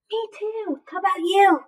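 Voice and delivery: usual speaking voice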